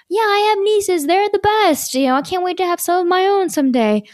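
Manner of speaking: mock enthusiasm, pitched well up; imitated eager date. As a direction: play a date delighted to be asked. Imitates keen date answering.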